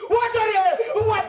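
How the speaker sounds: high, loud panicked shriek; old woman screaming. Retold or imitated. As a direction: scream in terror at the snake. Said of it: "मोठ्याने गागायची". (सापाला पाहून घाबरलेली म्हातारी किंचाळते)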